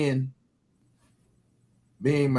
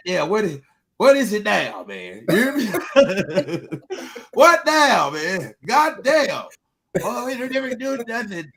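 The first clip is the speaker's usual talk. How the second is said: laughing, pitched-up mock of a nagging partner; mock nagging woman; 5 semitones higher than usual. Man imitates a nagging partner, laughing and high. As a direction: ham up the complaint, high and laughing. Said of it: "everybody like".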